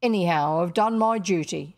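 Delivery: self-justifying working woman's voice; working-class Ellen. Acted. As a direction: declare it firmly with unrefined vowels. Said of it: "she reflected".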